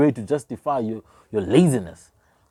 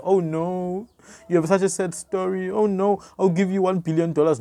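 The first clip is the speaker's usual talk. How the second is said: higher-pitched, hammed-up pity; mock sympathy; 6 semitones higher than usual. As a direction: feign pity for a sad story. Mocking imagined sympathisers' response.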